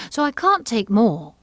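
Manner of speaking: loud, high, huffy Alice; offended. A narrator's reading. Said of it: "in an offended tone".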